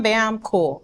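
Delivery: ordinary talk, nothing marked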